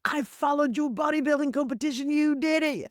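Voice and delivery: hint of a bodybuilder's Irish accent, friendly. Flex Lewis greeting her in his Irish accent. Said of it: "he's got this great Irish accent".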